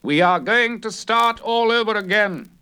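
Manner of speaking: high, insistent cry from the narrator; calling out. A narrator's reading. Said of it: "he calls out".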